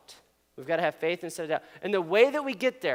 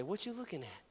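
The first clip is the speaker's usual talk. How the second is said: softer prompt for the crowd; quiet demo line; 2 semitones lower, 17 dB quieter than usual. Shown as a demonstration. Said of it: "look at the person next to you and say".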